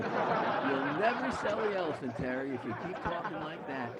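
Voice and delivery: loud, laughing repeat; laughing punchline. Real, not acted. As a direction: crow the punchline through laughter. Repeats punchline amid shared laughter.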